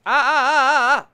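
loud high repeated ah screams; panicked scream. Real, not acted. Gamer screaming as purple hazard hurts him, panicked. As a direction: scream in alarm at the danger.

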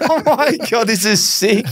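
high, delighted squeal of a laugh; excited laughing. Real, not acted. Cohost thrilled by the racing tip, laughing high. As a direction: gush like the tip is too good.

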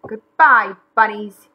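loud farewell call. A narrator's reading. McGreely leaving the bunnies behind, called out. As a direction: call it out over a distance. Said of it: "he called out".

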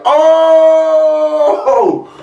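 startled yelp as a hawk dives past; shocked yelp. Real, not acted. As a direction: jump at the shock. Hawk swoops on the baby bird.

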